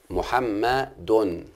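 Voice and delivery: محمد stretched very slowly as mu-ham-ma-dun; slow syllable-by-syllable demo. Shown as a demonstration. Teacher showing students each syllable of محمد, very slow. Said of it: "انظروا إلى النطق يا أولاد".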